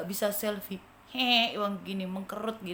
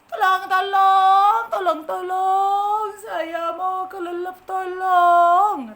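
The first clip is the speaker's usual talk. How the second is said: high, slow, loud wailing tolong; sing-song drowning plea; 7 semitones higher, 13 dB louder, much slower than usual. Acted. Ant about to drown wails for help. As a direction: draw out each cry as you go under. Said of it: "Ketika semut bilang".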